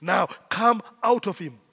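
firm order, no pleading; stern, deliberate dismissal; commanding. Retold or imitated. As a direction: command it out with authority. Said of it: "Jesus does not beg the devil".

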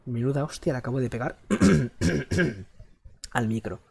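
ordinary delivery

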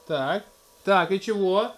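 ordinary delivery

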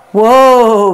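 calling out. A narrator's reading. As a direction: call the horse to a stop. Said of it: "Ruth called to her baby bay gelding".

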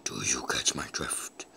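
very low, hushed whisper; creepy whisper. Pretend. Streamer teasing viewers in a low whisper. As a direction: whisper it like an innuendo.

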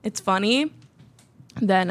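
ordinary talk

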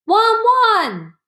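high, loud barking "Wan, wan!"; loud dog bark. A narrator's reading. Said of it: "Hachiko barked loudly".

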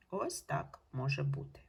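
ordinary delivery

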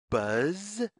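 ordinary delivery

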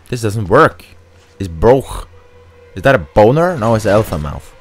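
ordinary talk, nothing marked

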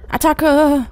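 loud high yell; battle cry. Real, not acted. Player rushing forward in the game, battle cry. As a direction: shout it like charging into battle.

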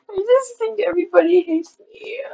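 high tearful whine, rising at the end; crying. Real, not acted. Vlogger feeling hated by viewers, high tearful whine. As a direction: wail it upward through tears. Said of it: "I don't know why I'm crying".

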